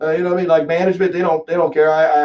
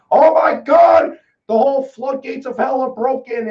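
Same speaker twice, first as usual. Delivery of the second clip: mock horror; 8 semitones higher, slower than usual. Mocking the outcry over Texas opening, drawn-out mock shriek. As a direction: stretch it out like fake outrage.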